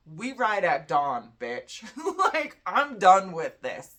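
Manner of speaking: a wounded warrior refusing to quit, mock-heroic; defiant card figure. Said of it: "He's like".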